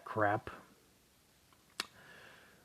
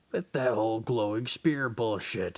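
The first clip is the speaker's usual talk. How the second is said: ordinary talk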